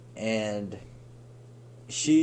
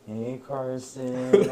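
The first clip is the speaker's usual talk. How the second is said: very slow, drawn-out greeting; mock flirty greeting; much slower than usual. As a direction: stretch the name out teasingly. Acts friend sidling up flirtatiously.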